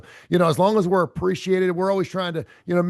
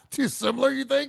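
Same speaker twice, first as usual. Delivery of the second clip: real laugh, pitch jumping higher; 10 semitones higher than usual. Real, not acted. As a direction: laugh through the question. Laughing at cohost's joke.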